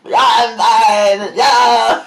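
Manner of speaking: loud, high drawn-out yell; drunk friend yelling. Retold or imitated. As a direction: imitate a drunk insisting loudly. Retold drunk friend insisting loudly.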